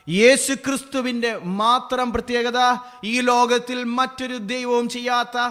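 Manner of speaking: impassioned preacher at full volume; full-force preaching. Acted. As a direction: thunder the wonder of the verse.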